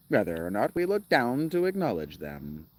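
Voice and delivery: exaggerated rise-and-fall narrator parody; mocking audiobook reader. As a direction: mimic the reader's swooping phrasing. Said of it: "exactly how he just said that".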